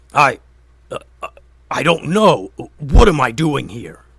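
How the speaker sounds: loud, dazed troll voice; bewildered troll thinking aloud; confused troll. A narrator's reading. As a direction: stammer like a troll waking from a spell. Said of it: "thought the troll out loud".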